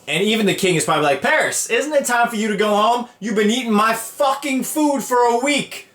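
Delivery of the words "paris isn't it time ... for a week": higher-pitched, fed-up complaint; mock exasperation. King to a guest who overstayed, mock fed-up. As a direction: nag an overstaying guest, playing it up.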